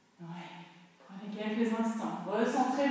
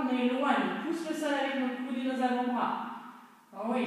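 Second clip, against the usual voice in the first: normal talking voice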